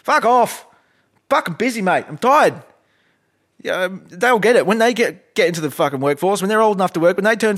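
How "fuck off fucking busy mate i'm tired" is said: put-on snapping at kids. Retort to pestering kids.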